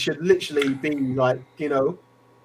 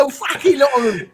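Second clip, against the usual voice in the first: mock Cockney fan; 9 semitones higher than usual. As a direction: do the Cockney lad once more. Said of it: "He's a proper Cockney lad".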